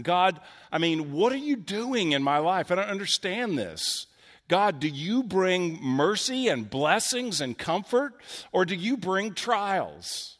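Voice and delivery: Jonah's exasperated pleading. Retold or imitated. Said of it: "Jonah's probably left asking".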